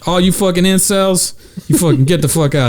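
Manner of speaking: high-pitched mock tirade at the crowd; vicious host. Host ordering incels out, high and mock vicious. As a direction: play an aggressive host clearing the room.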